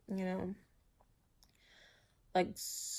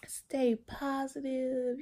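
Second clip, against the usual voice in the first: higher, sing-song mock well-wisher; imitating well-wishers; 6 semitones higher, 3 dB louder, slower than usual. Mimicking everybody's cheerful encouragement, lightly higher sing-song. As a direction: lightly mimic people saying they pray for you. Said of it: "everybody like".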